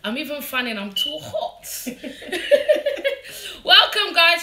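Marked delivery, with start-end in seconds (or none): 1.9-3.6 breathy high giggle; high laugh. Real, not acted. Laughs at own remark. 3.6-4.4 loud laughing welcome; excited. Real, not acted. Greeting live viewers laughing.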